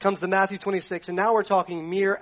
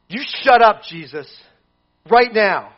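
louder, snapping shut up; mock rebuke; 2 semitones higher, 8 dB louder than usual. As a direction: put him in his place. Mock rebuke voiced as Peter.

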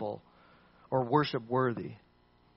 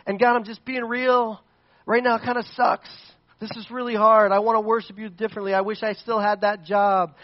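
raw honest lament; 5 semitones higher, 5 dB louder than usual. Acted. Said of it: "he gets to this point where he says".

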